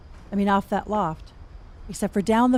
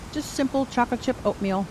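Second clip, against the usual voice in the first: talking through cookie; words round a bite of food; mouth full; 5 semitones higher than usual. Real, not acted. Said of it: "Now I'm talking with my mouth full".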